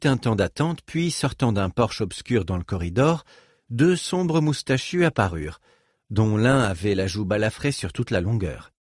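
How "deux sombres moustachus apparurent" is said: plain reading voice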